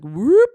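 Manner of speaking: loud rising cartoon whoop. Showing how the bump rose on her head, cartoon whoop. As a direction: imitate a cartoon bump popping up. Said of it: "one of those cartoon".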